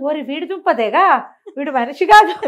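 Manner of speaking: stunned outcry. Retold or imitated. Ghost bride finds her husband is a ghost, stunned outcry. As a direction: gasp it out as the truth sinks in. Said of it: "నిర్ఘాంతపోయింది".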